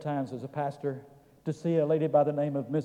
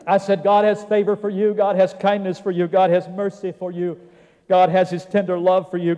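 loud rhythmic list, raised in pitch; preaching; 3 semitones higher, 8 dB louder than usual. Retold or imitated. Preacher telling a patient the ways God loves, forceful. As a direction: roll out each line with mounting conviction.

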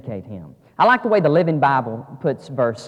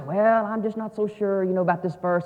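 mock doubtful reader; 6 semitones higher than usual. Preacher imitating a reader doubting this verse, mock hesitant. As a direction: imitate someone hedging uncertainly.

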